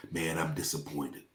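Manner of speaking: low, soft, weighty disappointment; disappointed pastor. Retold or imitated. International pastor rebuking him on a call, low and heavy. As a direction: speak down to him like a disappointed elder. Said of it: "he called me and he says".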